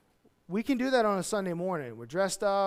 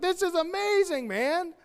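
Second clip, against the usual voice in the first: mock amazement; 9 semitones higher, slower than usual. Churchgoer's excited reaction.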